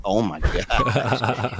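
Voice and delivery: slow, drawn-out oh my gosh through a laugh; slow laughing groan of disbelief. Retold or imitated. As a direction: laugh it out in mock horror. Reacting to a man hitting on him.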